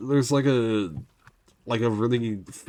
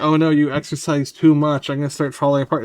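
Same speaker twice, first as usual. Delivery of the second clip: mock-panicked body, louder and higher; voicing the body; 5 semitones higher, 8 dB louder than usual. Body complaining about too much exercise, hammed-up fretful voice. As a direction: fret like a dramatic body. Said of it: "And it's like".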